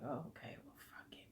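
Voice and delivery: unvoiced resigned whisper; whispered giving up. Giving up after her brother refuses, a defeated whisper. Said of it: "I was like".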